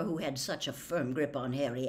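usual speaking voice

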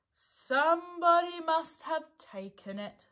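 high, slow, doleful complaint; gloomy. A narrator's reading. Eeyore blaming someone for his tail, gloomily. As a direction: moan it out slowly.

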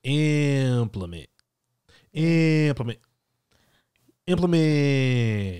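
slow, stretched "Implement"; each "Implement" said slow and heavy; odd emphasis. Shown as a demonstration. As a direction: draw out "Implement" with odd weight. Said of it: "Maybe you should implement that".

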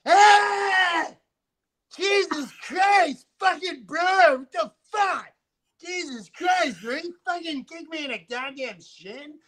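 high, slow, loud outraged yelp; mock pained yell. Norm kicked in the calf, hammed-up pained yell. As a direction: yelp like he just got kicked. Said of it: "he went".

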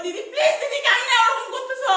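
very high, loud, desperate plea; distressed pleading. Real, not acted. Pleading with didi amid the quarrel, distressed pleading. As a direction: beg her loudly, voice pushed right up.

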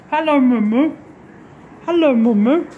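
high, loud sing-song hello to the dog; greeting Maggie the dog in a high, loud pet voice; pet talk. Real, not acted.

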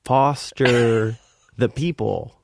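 drawn out so a foreigner can follow; slow for a non-English speaker. Retold or imitated. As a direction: say it in separate, deliberate chunks. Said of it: "maybe she doesn't understand English".

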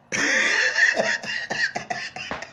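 long, breathy laugh trailing off; mocking laugh. Caller laughs at a shamed friend, mocking laugh. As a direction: laugh long and softly at his downfall. Mocking the man who must beg.

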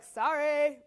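glib, loud little sorry; quick throwaway apology. Acted. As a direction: toss off an automatic sorry. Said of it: "that quick response of".

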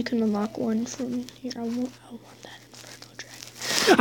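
breathy longing whisper. Real, not acted. Gamer wishing for the dragon, whispered. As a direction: whisper the wish to yourself. Craving a legendary card.

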